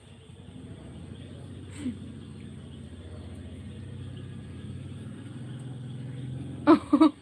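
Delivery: long, mostly voiceless laugh bursting out at the end; real laughing fit; helpless laugh. Real, not acted. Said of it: "I can't stop laughing".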